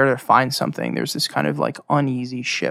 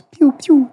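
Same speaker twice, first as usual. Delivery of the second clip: high, loud mock pew-pew sounds; playful arrow sound effects; arrow noises; 13 semitones higher, 7 dB louder than usual. GM teasing player with mock arrow sounds. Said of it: "Or like you shooting arrows".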